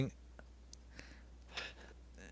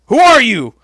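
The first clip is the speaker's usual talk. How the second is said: furious booming boss yell, much higher and louder; mock Vince McMahon; 9 semitones higher, 10 dB louder than usual. Imitating Vince yelling at wrestlers in his office, furious. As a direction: roar like an outraged boss catching intruders. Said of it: "and then Vince walks in".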